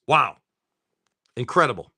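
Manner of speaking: ordinary delivery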